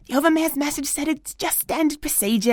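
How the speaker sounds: normal talking voice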